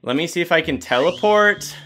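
ordinary talk, nothing marked

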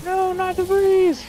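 slow, high mock anguished wail; Wicker Man bees scream. Friend answering the bees quote, slow high mock wail. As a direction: drag out the bees like a hammed-up Cage.

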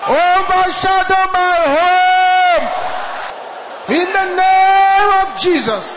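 full-voiced, high, slow shouted prayer. Real, not acted. Pastor leading the congregation in a loud prayer, shouting. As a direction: cry it out to heaven at the top of the lungs. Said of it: "shout this loud and clear".

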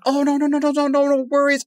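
high-pitched imitation of placating investors; hasty backpedalling. Voicing investors hastily backing down.

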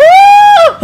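very high, loud shrieking laugh. Real, not acted. Gamer shrieking a laugh at a near two-wheel stunt.

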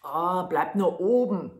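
a booming man's voice; loud gruff man. Acted. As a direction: call up to the boy on your hat. Man answers Daumestick.

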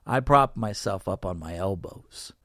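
ordinary delivery

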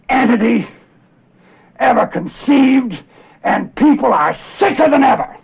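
very high, loud shouting, slow and hammered; outraged bellow. Acted. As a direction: bellow each word, climbing to a full shout. Tirade peaks at medicine's failure.

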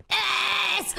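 high, sudden yelp of relief; triumphant shout. Real, not acted. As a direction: cry out with a gasp. Finally stopped before lava.

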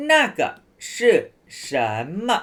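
那个 是 什 么 with a pause after each word; slow and separated. Shown as a demonstration. Teacher showing students the slow version nobody really uses. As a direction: spell it out word by word. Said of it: "แต่เขาพูดช้างี้ไหม".

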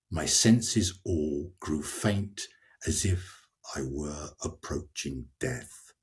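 slow, low verse reading that sinks and fades; poetry reading. Acted. Reading the pilgrim's faint to the listener, poetry reading.

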